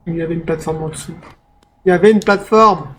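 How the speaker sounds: ordinary talk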